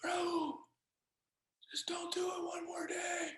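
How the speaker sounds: imitating a breathless whisper, slow and urgent; pleading near-whisper, barely any voice; dying father's whisper. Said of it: "And my dad would go".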